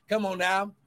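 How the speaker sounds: usual speaking voice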